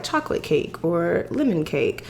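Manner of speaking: usual speaking voice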